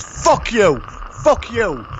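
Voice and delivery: high angry yell; shouting. Retold or imitated. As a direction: yell it at the monster. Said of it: "He goes".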